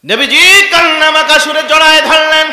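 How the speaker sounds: high, loud sermon cry; impassioned preaching. A narrator's reading. Preacher tells of the Prophet's embrace, high loud impassioned cry. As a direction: proclaim it at full force. Preacher narrates at emotional peak.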